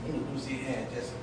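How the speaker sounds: low, soft mumbled pleasantries; mock casual greeting. Pastor imitating a hollow visit greeting, softly mocking. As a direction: mumble it like routine small talk. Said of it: "instead of just".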